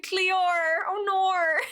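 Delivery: put-on Australian accent. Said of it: "The Australian accents".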